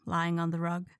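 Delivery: usual speaking voice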